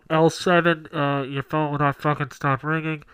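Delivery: page the seat number like an usher; flat echoey PA page. Pretend. Theater staff stopping movie.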